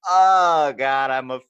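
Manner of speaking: loud, high drawn-out groan; mock cringe. Caught faking an accent, hammed-up cringing groan. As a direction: act out a wave of embarrassment. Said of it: "it's just that cringe".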